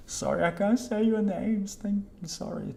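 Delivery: high-pitched, softening apology; sheepish high apology. Real, not acted. Streamer to viewer whose name defeats them, high apology. Apologizing for failing to read names.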